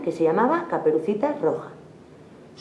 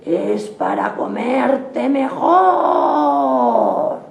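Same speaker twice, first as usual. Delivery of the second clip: very slow, drawn-out, higher wolf voice; menacing wolf; 6 semitones higher, 3 dB louder, much slower than usual. A narrator's reading. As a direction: savour every word like the hungry wolf. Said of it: "Y el lobo dice". (lobo amenazante, muy lento)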